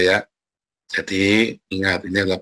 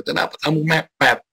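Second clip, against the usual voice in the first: higher nagging voice, a little less sharp; repeated mock scold; 5 semitones higher than usual. Preacher repeating his scold to the congregation, higher nagging tone. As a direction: nag again at the latecomer. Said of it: "kan gitu kan".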